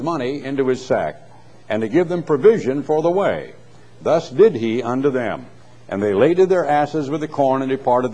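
lifted public reading of scripture. Acted. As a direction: read the King James like from a pulpit. Reads scripture aloud.

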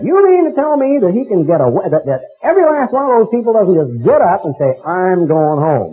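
high, incredulous outburst; retold disbelief. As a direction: sound stunned at what he saw. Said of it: "I said".

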